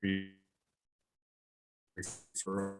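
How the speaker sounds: usual speaking voice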